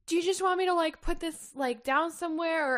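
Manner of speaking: high-pitched imitation of awkwardness; awkward offer. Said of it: "keeps awkwardly holding up the little gift basket".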